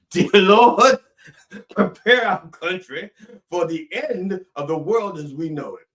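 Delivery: loud, high pulpit cry easing off at the end; mock preacher's prayer. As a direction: belt out a mock sermon prayer.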